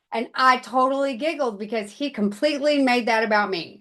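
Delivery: ordinary talk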